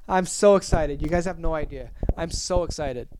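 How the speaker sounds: ordinary talk, nothing marked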